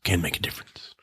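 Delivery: hushed, voiceless movie-trailer line; dramatic whisper. Pretend. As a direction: whisper it with mock gravity. Mock-dramatic film trailer line.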